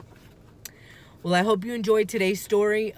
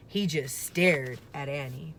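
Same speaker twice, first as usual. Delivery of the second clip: plain reading voice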